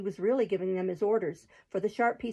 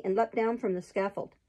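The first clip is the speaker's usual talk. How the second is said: ordinary delivery, nothing marked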